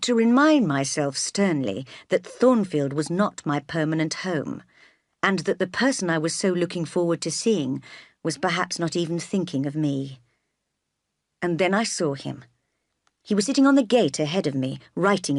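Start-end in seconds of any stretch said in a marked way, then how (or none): none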